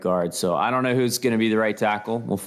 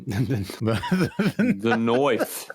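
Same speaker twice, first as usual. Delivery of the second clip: put-on Australian accent; 9 semitones higher than usual. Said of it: "[name] doing the Australian".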